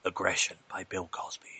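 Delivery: hushed brand-name whisper; whispered tagline. Acted. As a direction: breathe the product name.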